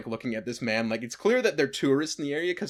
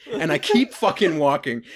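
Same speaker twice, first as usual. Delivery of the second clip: ordinary talk, nothing marked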